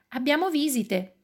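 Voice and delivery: put-on high child voice announcing visitors; bambino che avvisa. A narrator's reading. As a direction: announce it high, like a little boy. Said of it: "dice Filippo". (voce acuta di bambino)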